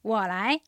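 "我来" said clearly in Mandarin tones for repetition; Chinese model phrase. Shown as a demonstration. Teacher giving listeners the phrase to repeat, clear Mandarin. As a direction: model "我来" crisply as a native would.

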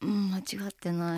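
low, very soft reluctant admission; tiny, sheepish murmur; small voice. Real, not acted. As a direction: admit it meekly, barely audible. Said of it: "声ちっちゃいな".

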